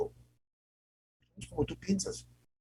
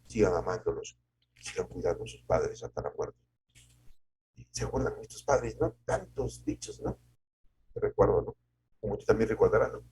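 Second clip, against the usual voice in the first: usual speaking voice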